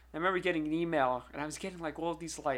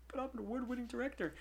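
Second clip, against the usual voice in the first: softer, pleading "award-winning director"; a weak appeal to the store manager; small, half-hearted insistence; deflated protest; 6 semitones higher, 11 dB quieter than usual. Retold or imitated. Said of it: "And I'm like".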